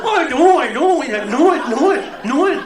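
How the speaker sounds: loud, high, stuck on know it; stammering shout. Acted. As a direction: hammer the repeated words, laughing.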